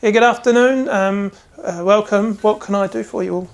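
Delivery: a lad greeting shop customers; eager young apprentice. Acted. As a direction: welcome the customers a bit nervously. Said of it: "this young man goes".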